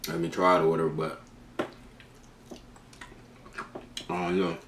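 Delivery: biting into a wing; talking while chewing. Real, not acted. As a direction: mouth full of chicken. Seen 0: holds wing throughout, bites it at 3.5-5.4 and 7.3s.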